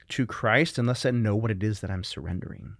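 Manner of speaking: normal talking voice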